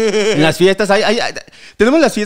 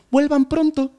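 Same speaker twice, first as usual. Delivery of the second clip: high-pitched send-off; raised mock farewell; cemetery worker's farewell; 8 semitones higher, slower than usual. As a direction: voice the worker seeing visitors off, pitched up.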